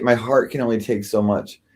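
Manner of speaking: ordinary talk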